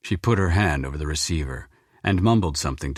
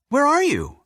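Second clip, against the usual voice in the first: much higher, eager daughter's question; excited; 5 dB louder than usual. Acted. Daughter thrilled her father is calling, excited. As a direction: leap up with joy at hearing her dad. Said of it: "she said excitedly".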